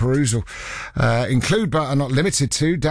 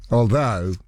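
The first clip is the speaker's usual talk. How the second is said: usual speaking voice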